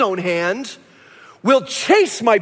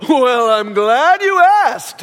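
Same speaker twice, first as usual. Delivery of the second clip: slow, drawn-out song-and-dance showman; showman God; 5 dB louder, much slower than usual. Pretend. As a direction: tip the top hat and croon it slowly. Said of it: "God would show up in a top hat and a cane and go".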